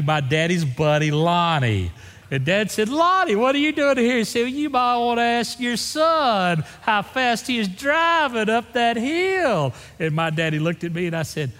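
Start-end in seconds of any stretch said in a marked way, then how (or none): 2.9-4.2 dad's voice. Retold or imitated. Dad voiced in retold story. 4.4-9.7 slow, higher lawman voice; lawman's drawl. Retold or imitated. Lawman voiced in retold story.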